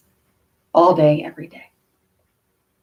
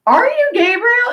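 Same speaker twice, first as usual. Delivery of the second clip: much higher, awed question; 4 dB louder than usual. Retold or imitated. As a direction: ask it in sudden wonder. Asks therapist if she's Gabriel.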